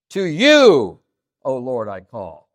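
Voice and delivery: heavy stress on "you", the rest subdued; emphasis on TO YOU. Shown as a demonstration. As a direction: ring out "you", pause, then slow and settle. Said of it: "It's emphasizing the "to you".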